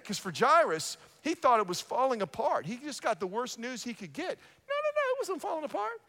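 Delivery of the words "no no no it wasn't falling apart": very high, squeaked denial; squeaky mock-alarmed correction; high-pitched protest. Corrects Jairus's fear, squealed voice.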